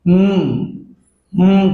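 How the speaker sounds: হুম as a held humming m; sustained closed-lip mmm in হুম; letter sound demo. Shown as a demonstration. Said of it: "এম সাউন্ডটা এসেছে".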